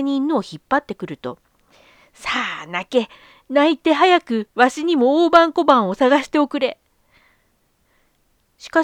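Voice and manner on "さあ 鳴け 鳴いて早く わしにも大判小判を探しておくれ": put-on loud, pushy old man; demanding old man. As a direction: order the dog about greedily. Greedy old man orders dog.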